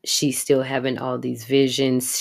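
ordinary delivery

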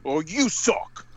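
drawn-out schoolyard taunt; slow mocking comeback. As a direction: sneer it slowly like a kid. That was just quoting the character.

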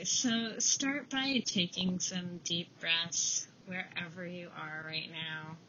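calm them down with a quiet voice; guided meditation. Acted. Leads a breathing meditation.

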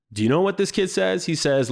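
usual speaking voice

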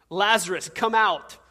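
commanding call. Retold or imitated. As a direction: summon him with a loud command. Jesus calling the dead man out.

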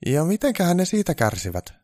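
high-pitched, tentative elf voice; careful, wary little inquiry; cautious question. Acted. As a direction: ask the boss gingerly, pitched well up. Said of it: "Onni kysyi varovaisella äänellä".

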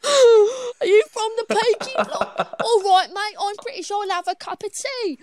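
high, giggly mock British accent; cartoonish Peaky Blinders British; exaggerated British accent. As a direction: ham up a British accent through laughter. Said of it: "we're gonna stop with the accents".

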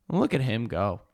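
low, soft imitation of a proud mom; proud soccer mom. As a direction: lightly mimic an admiring sideline parent. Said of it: "the most proud soccer mom".

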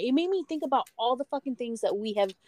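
normal talking voice

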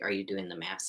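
hushed whispered aside. Real, not acted. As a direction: whisper it under your breath. Aside to co-host during counting.

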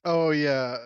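usual speaking voice

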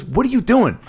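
high, incredulous demand; exasperated producer. Retold or imitated. Producer, fed up with the wig guy, asks what he's doing. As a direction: pitch it way up in disbelief. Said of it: "He goes".

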